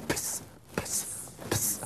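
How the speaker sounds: "piss" as a bare ps, almost no vowel; lip sound before S. Shown as a demonstration. Teacher shows psi's sound, lip pop before the S. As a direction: pop the lips straight into a hiss for "piss". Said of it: "加了一个唇音在前面".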